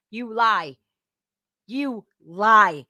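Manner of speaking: ordinary talk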